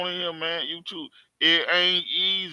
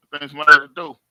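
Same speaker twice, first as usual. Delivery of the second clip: called out loud toward the door; 4 semitones lower, 9 dB louder, much faster than usual. Real, not acted. Vlogger hearing a knock, calling it out loudly. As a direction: announce it loudly, like someone's knocking.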